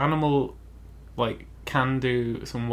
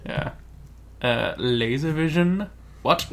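ordinary talk, nothing marked

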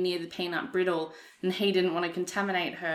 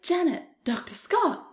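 Rocky Horror name call; 11 semitones higher, 6 dB louder, slower than usual. Retold or imitated. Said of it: "scene in Rocky Horror where it's like".

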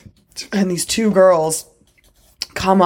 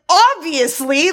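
higher, drawn-out "Obviously"; sarcastic; 8 semitones higher, slower than usual. Retold or imitated. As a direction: lay on the sarcasm, slowly. Retold own sarcastic reply.

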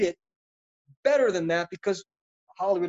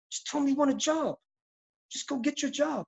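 higher, softer mentor's voice; quiet high-pitched imitation of a mentor; Richard Pryor; 8 semitones higher, 4 dB quieter than usual. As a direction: lightly urge it as an older friend's advice, pitched up. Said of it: "He said".

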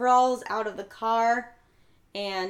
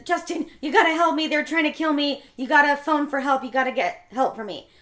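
higher, louder acted pleading; urgent plea; 4 semitones higher, 5 dB louder than usual. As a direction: retell a desperate call for help. Voices Grace begging for help.

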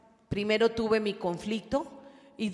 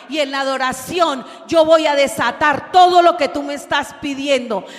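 loud, shouting sermon climax; preaching at full force; 3 semitones higher, 6 dB louder than usual. Acted. Preacher shouting God's promise to the congregation.

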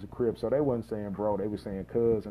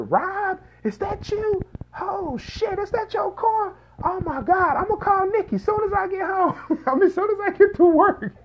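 high-pitched excited woman's voice, much higher; Kim's surprised voice; 6 dB louder than usual. Retold or imitated. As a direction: squeal it out like a startled cousin, laughing at the end. Cousin Kim spots him at crash.

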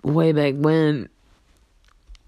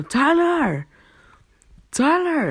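calling out; 11 semitones higher, slower than usual. Retold or imitated. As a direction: call your friend from far off. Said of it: "And I go".

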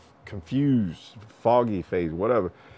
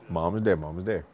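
ordinary talk, nothing marked

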